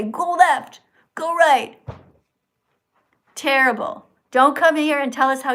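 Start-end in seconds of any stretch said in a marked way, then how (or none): 0.0-1.8 bossy barked commands, louder and higher; mock rider commands. Mimicking riders giving orders to horses. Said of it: "and say".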